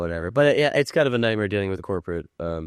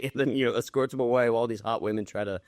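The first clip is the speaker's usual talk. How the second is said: usual speaking voice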